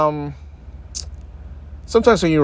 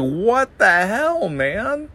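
exasperated; 9 semitones higher, much slower than usual. Retold or imitated. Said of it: "it's just like".

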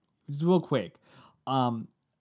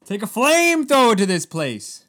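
louder, higher echo of the rant; mock angry repeat; 5 semitones higher, 7 dB louder than usual. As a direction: shout the threat back, pumped up. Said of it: "he just said it".

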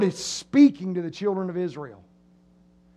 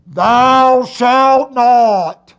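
booming, very slow, drawn-out command; voice of God; 9 dB louder, much slower than usual. Acted. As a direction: thunder it like an intimidating God from the mountain. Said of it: "you hear a voice in the middle".

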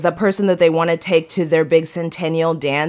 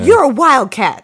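cheer quote; 7 semitones higher, 4 dB louder than usual. Retold or imitated. Quoting High School Musical.

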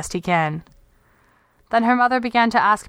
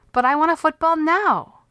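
put-on high, insistent little girl's voice; a girl demanding it right now; seven-year-old, high and stubborn; demanding child; 8 semitones higher than usual. A narrator's reading.

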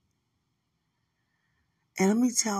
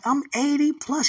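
playful angel; 8 semitones higher, 7 dB louder than usual. Retold or imitated. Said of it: "one was like".

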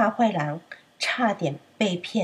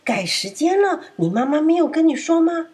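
high, louder mock astonishment; wolf faking surprise to trick the lamb; hammed-up pretend shock; fake surprise; 8 semitones higher, 4 dB louder than usual. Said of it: "大野狼假装惊讶地说：".

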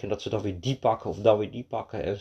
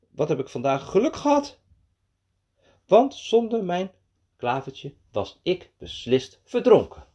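put-on expressive children's storytelling with high, excited dialogue; animated story narration; 6 semitones higher than usual. A narrator's reading. Narrator telling of Mees and duck and rabbit, animated storytelling. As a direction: relish every exclamation and mishap.

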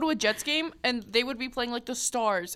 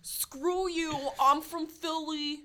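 put-on higher, drawn-out Philly accent gone Boston; attempted Philly accent; 4 semitones higher, much slower than usual. Imitating a defiant Flyers fan, mock Philly accent. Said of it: "so hard to do that accent".

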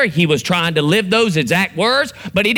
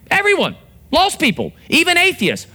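words thrown up much higher; emphatic high; 12 semitones higher than usual. Real, not acted. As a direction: hammer the point home. Preacher drives home point.

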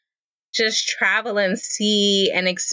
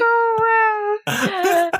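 laughing mock outrage. Real, not acted. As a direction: pretend to be scandalized, laughing.

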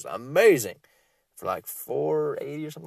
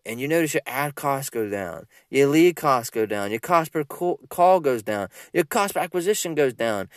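ordinary talk

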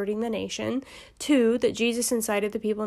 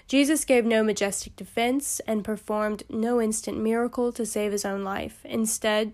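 ordinary talk, nothing marked

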